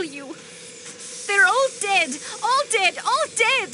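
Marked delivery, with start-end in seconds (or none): 1.3-3.7 louder and higher with each anguished "all dead"; anguished outcry. Acted. Countess pressed about her sister's household, anguished rising outcry. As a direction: cry it out, building in anguish. Countess breaks down over family.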